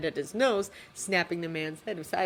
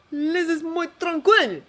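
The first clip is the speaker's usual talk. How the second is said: high, loud broken plea; pleading director; 12 semitones higher, 10 dB louder than usual. Acted. Director tries to calm Jake, high loud plea. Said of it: "the man tries".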